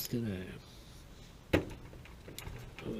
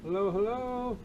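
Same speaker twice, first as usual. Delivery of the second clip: raised, high calling out; calling down a hallway; 11 semitones higher than usual. Real, not acted. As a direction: call out into the empty house. Calling out entering a house.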